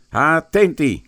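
loud, high shouted order; shouted command. Acted. Finn calling the men to attention, shouted order. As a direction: bark it like a drill sergeant. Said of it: "gridò Finn".

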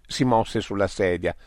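ordinary delivery, nothing marked

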